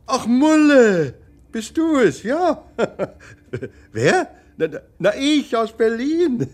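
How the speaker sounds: light up at hearing her voice; delighted surprise. Acted. Husband greets wife on phone. (freudig überrascht, hoch und laut)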